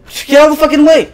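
high, loud, fast outburst; exasperated yell. Real, not acted. Player to a game character in the way, exasperated yell. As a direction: yell at whoever is in your way.

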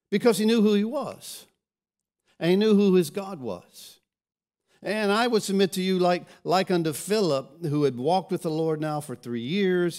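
loud, rising sermon climax, pressing the point home; preacher hammering the point home, loud and insistent; preaching at full force. Acted. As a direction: drive it home to the congregation.

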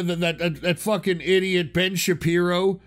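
ordinary talk

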